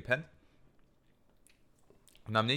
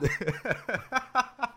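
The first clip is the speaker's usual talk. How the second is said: high laughter; 12 semitones higher than usual. Real, not acted. Laughing at his own story.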